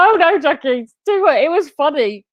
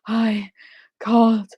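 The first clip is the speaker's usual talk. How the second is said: slow, breathy-halting Stevie impression, word by word; much slower than usual. Lightly imitating Stevie from Malcolm in the Middle for mum. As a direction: gasp each word out like Stevie. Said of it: "He'd speak like that".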